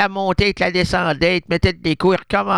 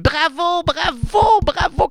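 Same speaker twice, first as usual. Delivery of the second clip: high praise; 10 semitones higher, slower than usual. Acted. Praising a pet.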